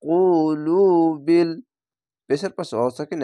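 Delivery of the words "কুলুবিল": 쿨루빌 drawn out slowly, chanted recitation; one alif stretch. Shown as a demonstration. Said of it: "এক আলিফ টেনে পড়বেন".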